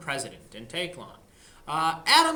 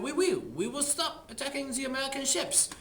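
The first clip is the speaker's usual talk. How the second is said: lightly put-on French accent, higher; a playful French agent promising; mock French accent; 7 semitones higher than usual. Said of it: "And they said".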